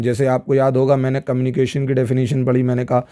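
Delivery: normal talking voice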